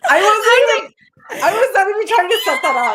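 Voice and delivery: laughing loudly while talking; loud amused laugh through the words. Real, not acted. Laughing at an accidental pun.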